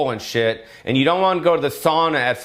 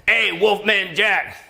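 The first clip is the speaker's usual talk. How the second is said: high, slow DJ growl; hammed-up Wolfman Jack howl; 7 semitones higher, slower than usual. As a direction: do a drawn-out radio DJ call. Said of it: "sound like Wolfman Jack".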